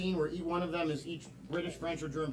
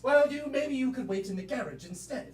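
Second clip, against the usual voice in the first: much higher posh British lady voice; mock British accent; 4 semitones higher than usual. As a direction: play the mother-in-law in a hammed-up British accent. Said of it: "British, French, and German".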